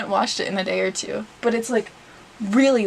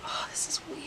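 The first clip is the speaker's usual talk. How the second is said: very soft whisper of disbelief; uneasy whisper; 15 dB quieter, faster than usual. Real, not acted. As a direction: whisper it to yourself. Stunned mid-haircut.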